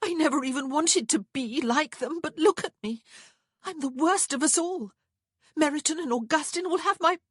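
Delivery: high, loud, despairing rant; distraught. A narrator's reading. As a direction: pour it out in panic until cut off. Despairing rant, cut off by Stanhope.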